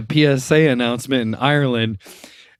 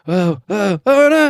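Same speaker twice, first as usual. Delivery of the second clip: mock panic; 8 semitones higher, 4 dB louder than usual. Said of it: "He's like".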